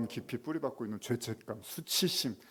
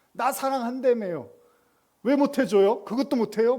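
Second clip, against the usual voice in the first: high, loud petulant whine; whiny complaint; 12 semitones higher, 6 dB louder than usual. Acted. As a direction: pout and demand. (사랑한다면서 왜 안 해주냐는 높고 큰 투정)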